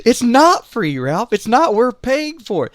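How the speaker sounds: high, loud scolding of a stubborn husband; exasperated scolding. Retold or imitated. Mom scolds dad across the gas station, loud and exasperated. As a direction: snap at him like a fed-up wife.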